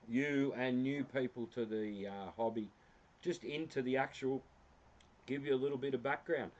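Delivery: ordinary delivery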